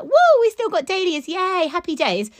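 high-pitched mock cheer; retold excitement. Grower recalling surprise at late dahlias, excited. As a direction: whoop with delight, recalling it. Retelling their delight at November dahlias.

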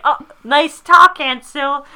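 high, loud mock scolding. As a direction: tease like a prim niece. [name] quoted scolding aunt.

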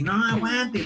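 pleading. Retold or imitated. Begging cousins to wait.

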